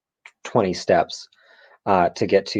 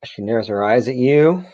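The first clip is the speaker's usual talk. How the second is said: ordinary talk, nothing marked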